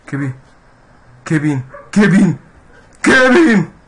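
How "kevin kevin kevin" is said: calling out loudly. Real, not acted. Calling out in fright.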